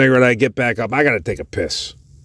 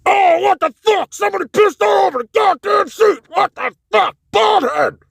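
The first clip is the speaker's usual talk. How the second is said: high shouting through a door; muffled angry yell. Retold or imitated. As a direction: yell it like it's behind a wall. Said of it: "this muffled voice".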